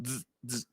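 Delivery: two soft, short electric zaps; voiced dz bursts; live-wire dz buzz. Shown as a demonstration. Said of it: "un cable pelón".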